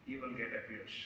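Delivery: low, soft falling answer; solemn consequence. Acted. Son states nature's lesson on abuse, solemn and hushed. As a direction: let the warning drop to a hush.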